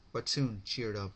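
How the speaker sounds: ordinary delivery, nothing marked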